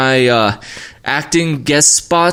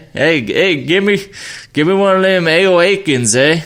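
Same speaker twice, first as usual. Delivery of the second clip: hammed-up Canadian accent, pitched higher; goofy Canadian accent with a tagged-on eh; mock Canadian accent; 6 semitones higher than usual. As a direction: play a jokey Canadian asking for a beer, eh. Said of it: "I could talk like this".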